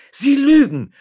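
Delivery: high, loud, outraged interruption; sharp cry of indignation; screamed accusation. A narrator's reading. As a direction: cut in with a furious shout. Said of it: "schrie die Navareserin".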